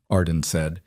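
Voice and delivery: ordinary delivery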